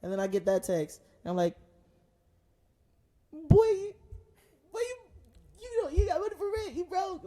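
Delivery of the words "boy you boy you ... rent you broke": mock scolding. Calling out roommate in text.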